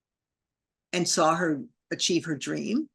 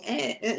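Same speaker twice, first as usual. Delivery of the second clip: small, higher grunts; short involuntary heaving noises, imitated; imitated rolling contractions; 4 semitones higher, 4 dB quieter than usual. Shown as a demonstration. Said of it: "the contractions that were going".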